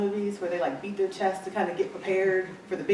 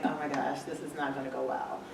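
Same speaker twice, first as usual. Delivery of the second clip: quiet inner dread; 6 semitones lower, 9 dB quieter than usual. Retold or imitated. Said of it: "I'm just like".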